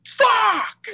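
sharp yelled curse at a character's death; retold yell. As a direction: blurt it like the shock in the theatre. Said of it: "I just yelled".

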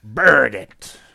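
loud pirate shout. Pretend. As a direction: call the name like a pirate. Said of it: "wel Birgit zeggen".

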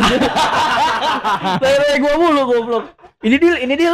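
laughing while talking; high, giggly protest. Real, not acted. Laughing at friend's joke.

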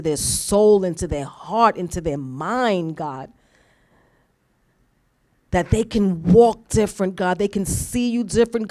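earnest closing prayer with emphatic swells; fervent prayer. Real, not acted. Preacher thanking God for the congregation, fervently praying. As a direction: pray aloud for the congregation, punctuating with God and Lord.

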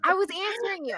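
high, loud retold protest; indignant protest. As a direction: defend yourself, acting out an old argument. Retold protest to accusing parent.

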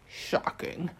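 whispered, voiceless disgust; breathy disdain. A narrator's reading. God of air scolds mortals in a whisper. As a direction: breathe the word out in scorn.

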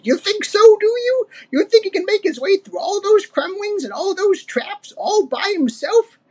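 put-on very high scowling old ape; Cranky. A narrator's reading. Old ape doubting Diddy, scornful. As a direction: sneer doubtfully in a high codger voice. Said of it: "Cranky scowled".

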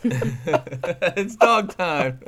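very slow lazy repeat; drawn-out echo. Retold or imitated. Repeats catchphrase slowly.

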